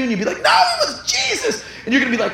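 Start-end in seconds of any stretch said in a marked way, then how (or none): 0.4-1.6 squeaky mock reply. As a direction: jump to a falsetto with a laugh.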